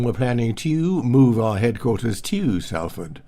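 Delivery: each "to" said fully as "tyoo"; over-pronounce "to" as "tew"; enormous "to". Shown as a demonstration. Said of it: "has become".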